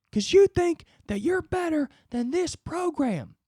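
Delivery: high, ranting drill coach voice; tough coach. Pretend. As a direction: lay into him like a furious football coach. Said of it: "I wanna look at all my roommates and be like".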